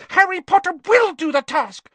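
high, loud elf squeak; squeaky house elf. Acted. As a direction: squeak it out urgently. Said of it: "squeaked the elf".

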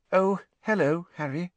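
brittle voice. A narrator's reading. Hermione greets Harry when found alone, brittle voice. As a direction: greet him in a thin, brittle high voice. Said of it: "she said in a brittle voice".

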